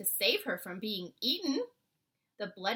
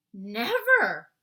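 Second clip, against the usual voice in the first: loud, earnest protest; insisting to the giant that it's no trick; passionate cry; 12 dB louder than usual. A narrator's reading. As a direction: cry it out with conviction. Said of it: "cried the B F G passionately".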